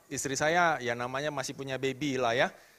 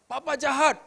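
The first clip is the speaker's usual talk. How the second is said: put-on high, a bit louder child's complaint; child's cry retold; 9 semitones higher, 6 dB louder than usual. Preacher recalling his child's shout, higher and louder. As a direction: repeat the child's accusation, raised. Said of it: "anak saya teriak".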